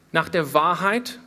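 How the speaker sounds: ordinary delivery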